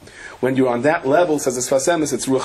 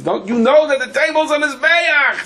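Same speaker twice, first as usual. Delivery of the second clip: mocking kid voice; 11 semitones higher, 3 dB louder than usual. Kids quarrel, retold mockingly.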